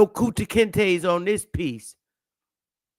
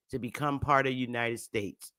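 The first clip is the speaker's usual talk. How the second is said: ordinary talk, nothing marked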